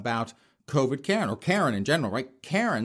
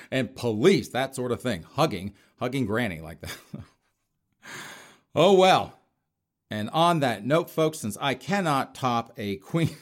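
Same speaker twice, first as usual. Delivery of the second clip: ordinary delivery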